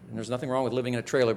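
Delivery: ordinary delivery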